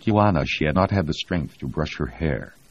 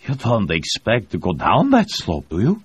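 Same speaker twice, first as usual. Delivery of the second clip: incredulous; 6 semitones higher, 4 dB louder than usual. A narrator's reading. Pilot doubts commander's plan.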